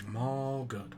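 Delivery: normal talking voice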